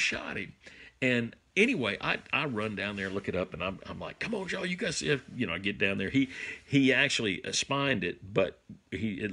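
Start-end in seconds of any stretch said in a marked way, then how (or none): 4.2-5.2 higher, fast and eager; excited call. Retold or imitated. Father calling friends to the downed buck, excited. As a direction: rush them over to see the buck.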